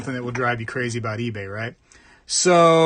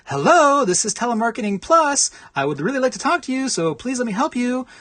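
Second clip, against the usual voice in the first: high, peppy sales-call voice, a bit fast; overly cheerful phone pitch, much higher; eager telemarketer; 9 semitones higher, 3 dB louder than usual. Retold or imitated. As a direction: gush like a first-day telemarketer. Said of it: "every single phone call is like".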